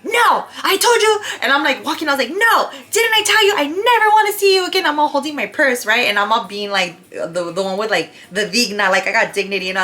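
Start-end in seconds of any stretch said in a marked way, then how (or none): 0.0-1.1 sharp high yell of refusal. Retold or imitated. To the date trailing her car, a high angry yell. 2.3-4.9 sharp high yell of refusal. Retold or imitated. To the date trailing her car, a high angry yell.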